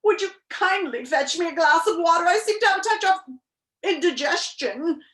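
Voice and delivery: high, loud, distressed gasping; gasped. A narrator's reading. Humbug begging Milo for water with indigestion, distressed gasp. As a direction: gasp it out, breaking before the last word. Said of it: "turned to Milo and gasped".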